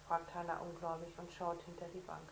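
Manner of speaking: usual speaking voice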